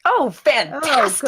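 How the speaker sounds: high, drawn-out mock delight; loud-hearted fake enthusiasm; sarcastic dismay. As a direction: slowly exclaim it with heavy sarcasm. Knife put to character's throat.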